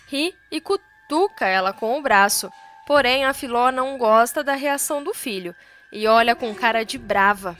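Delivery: usual speaking voice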